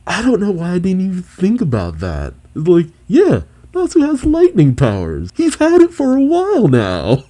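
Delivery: high-pitched, excited disbelief; giddy realization. Real, not acted. Host realizes Natsu has lightning powers, giddy and high.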